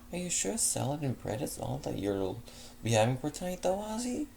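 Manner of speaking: a light, caring hostess asking; refined mare checking on her guest; Fleur's voice. Acted. Fleur questions his small order.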